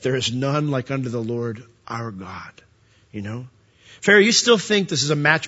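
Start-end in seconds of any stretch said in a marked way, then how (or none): 0.0-3.5 hushed, unvoiced whisper of the verse. Preacher hushing Moses' words to Pharaoh into a whisper. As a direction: breathe the words with reverence.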